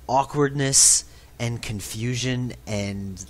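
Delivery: ordinary delivery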